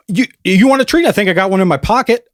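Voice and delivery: high pet voice, quick at the end; talking to a dog. Retold or imitated. Neighbor coaxing the dog, high and sweet. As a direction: coax a puppy with a treat. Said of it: "And he goes".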